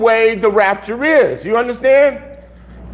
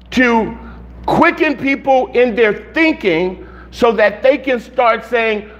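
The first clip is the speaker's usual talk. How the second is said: ordinary talk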